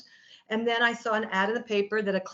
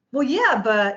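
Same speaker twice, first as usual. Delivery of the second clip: protesting; 3 semitones higher, 7 dB louder, slower than usual. Retold or imitated. Patient objecting to nutritionist's promise, loudly protesting. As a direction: blurt out a mock protest.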